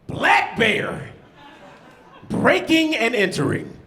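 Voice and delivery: high, dramatic newscaster headline; news anchor voice. Retold or imitated. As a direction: announce it like a breathless TV anchor. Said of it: "Here go the news anchor".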